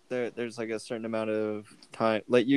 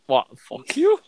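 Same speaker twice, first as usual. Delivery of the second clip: mock outraged; 3 semitones higher, 9 dB louder, slower than usual. Real, not acted. Mock outrage at being cut off.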